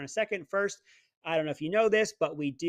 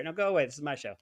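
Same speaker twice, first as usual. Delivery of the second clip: normal talking voice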